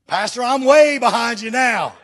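loud laughing slow retreat; mock backing off. Offended follower loudly laughing off his pastor, slow. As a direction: laugh it out as you back away. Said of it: "a few people get offended".

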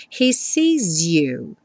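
very slow, "sees" ending in Z into "you"; Z-linked sees you. Shown as a demonstration. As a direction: buzz the S of "sees" as Z. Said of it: "o S do sees ... som como um Z". (S de "sees" soando como Z)

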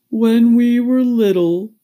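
high, loud, slow and woeful; doleful turtle recalling childhood; tearful Mock Turtle. A narrator's reading. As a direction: wail it slowly. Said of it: "though still sobbing a little".

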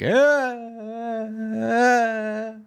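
high squeaky creaks; chair squeak imitation. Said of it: "affect the sound with the microphone".

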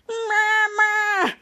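put-on childlike creature voice, held long; Monsty. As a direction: bleat it like a big baby monster. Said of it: "Monsty's like".

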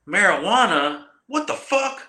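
very high, mock-baffled officer; imitating a dumbfounded lawman; incredulous. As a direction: squeak up high in disbelief. Acting out the marshal's baffled reaction.

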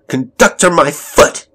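high, loud, scornful shout; explosive, derisive yell. A narrator's reading. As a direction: shout the idea down angrily. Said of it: "shouted Elizabeth".

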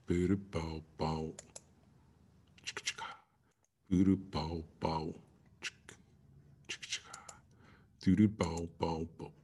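low, lazy "boo-doo-bow-bow"; mouthed riff. Real, not acted. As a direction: imitate a funk riff under your breath. Vocal filler beat while waiting.

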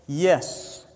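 small, low, soft reply; imitating a very quiet freshman; almost inaudible. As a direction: shrink the voice down to a timid, barely audible answer. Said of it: "in the quietest voice possible, he almost inaudibly said".

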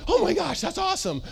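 high, over-excited oh my gosh; flattering friend gushing over any plan. Acted. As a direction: squeal the praise like a yes-man.

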